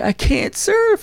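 high, slow, mock crying Swayze. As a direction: wail it like he's about to cry. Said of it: "Swayze's like".

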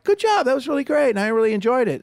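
high-pitched imitated audience compliments; retold sweet praise after a dead show; polite praise. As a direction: mimic polite fans, pitch way up.

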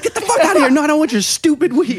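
high, loud exasperated yell. Retold or imitated. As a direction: throw him out, fed up. Retold angry refusal.

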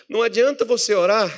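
usual speaking voice

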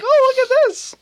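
high, loud whiny mock voice. Friend mimicking Palpatine in a whiny voice. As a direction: whine it out like a spoiled kid. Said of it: "A whiny".

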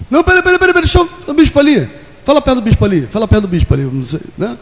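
high, fast, flustered stalling; flustered believer. Acted. Preacher mimics a jittery believer, in a flustered high voice. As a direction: stall nervously, words tumbling out high.

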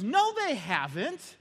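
high, slow mock wife; wife disagreeing. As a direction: contradict him firmly. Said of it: "she goes".

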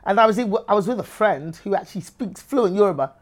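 usual speaking voice

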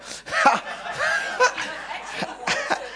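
high burst of laughter. Real, not acted. Preacher amused by a big Bible word, laughing. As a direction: laugh out in a long, high run.

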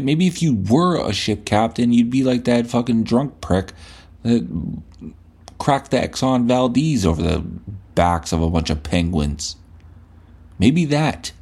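ordinary talk, nothing marked

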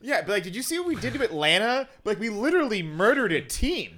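high, loud, fast gloating; loud, high bragging football fan. Retold or imitated. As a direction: rub it in like a fan. Boasting football fan quoted.

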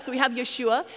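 ordinary delivery

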